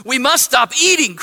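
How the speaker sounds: urgent cry. A narrator's reading. Toad cries out while eating another cookie, urgent cry. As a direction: cry it out in mild alarm. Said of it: "cried Toad".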